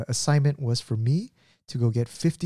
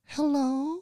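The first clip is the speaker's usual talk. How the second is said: put-on very high, sweet old lady hello; grandma greets Daniel at the door, high and sweet. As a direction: chirp it like a tiny grandma. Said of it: "she was like".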